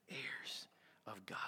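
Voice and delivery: slow, hushed whisper; barely audible, reverent whisper; awed whisper. Real, not acted. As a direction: whisper it slowly, letting it sink in. Repeats the phrase in awe.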